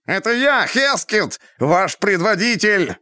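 high, slow shrieking rat voice; shrill screeching bird woman. A narrator's reading. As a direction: squeal it out desperately. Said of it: "пронзительно завизжал он".

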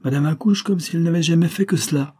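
high-pitched sly remark; mischievous exclamation. A narrator's reading. Mischievous bonesetter comments on the birth, high exclamation. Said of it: "le malicieux rebouteur s'écria".

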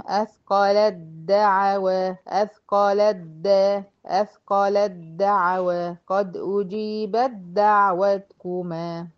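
slow أثقلت دعوى and قد أجيبت دعوتكما with ت merged into د; slow recitation of the examples; recited examples. Shown as a demonstration. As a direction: chant each example slowly. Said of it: "هقول إدغام".

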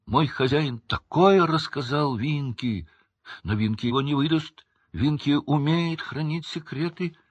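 high, emphatic elf voice; indignant. A narrator's reading. Winky guarding her master's secrets, indignant. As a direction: insist with a touch of indignation. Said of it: "глаза её возмущённо засверкали".

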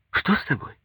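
lightly voiced puzzled wife. A narrator's reading. Wife asks, puzzled by husband's silence.

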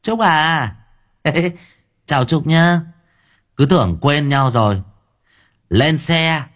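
drawling voice. Acted. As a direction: drag the words like a loose-mouthed driver. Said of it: "Giọng lè nhè".

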